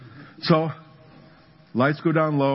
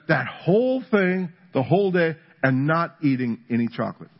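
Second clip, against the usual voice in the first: usual speaking voice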